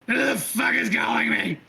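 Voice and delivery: loud, high furious shout into the communicator; angry yell. Acted. Sister yelling at the call, furious shout. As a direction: bark it out, annoyed at the interruption.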